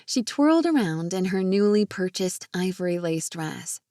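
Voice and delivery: ordinary delivery, nothing marked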